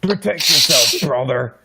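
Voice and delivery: gruff wrestler catchphrase; Hogan catchphrase voice. Retold or imitated. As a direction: bark it like a wrestler. Quoting Hogan's motto.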